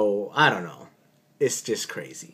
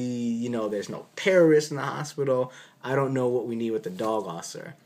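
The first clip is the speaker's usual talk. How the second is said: usual speaking voice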